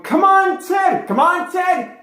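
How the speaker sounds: high, loud cheering yell; two friends urging the bowler on, shouting. Retold or imitated. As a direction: yell him on to the strike. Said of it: "I hear Peter and Ike going".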